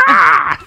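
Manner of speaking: high squealing shriek; cartoon scream. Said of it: "the flag goes".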